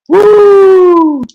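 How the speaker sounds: very high, loud excited whoop; real sugar-rush cheer. Real, not acted. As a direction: let out a wild party woo.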